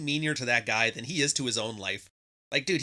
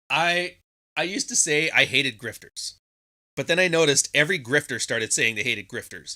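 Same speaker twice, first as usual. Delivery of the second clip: ordinary talk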